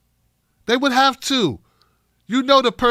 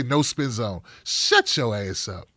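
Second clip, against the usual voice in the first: mock cable-news host voice, much lower; Bill O'Reilly impression; 9 semitones lower, 6 dB quieter than usual. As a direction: growl it like a smug TV host. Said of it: "like that Bill O'Reilly's punk ass used to say".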